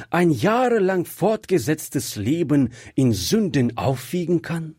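usual speaking voice

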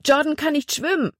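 high, alarmed cry; frightened cry. A narrator's reading. Libby cries out in fear as Jordan falls overboard. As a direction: blurt it out in sudden fear.

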